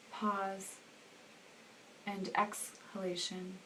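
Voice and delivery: soft, hushed meditation voice with long pauses; guided meditation. Acted. Meditation leader settling listeners into breath awareness, softly guiding. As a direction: guide them quietly into the breath, unhurried.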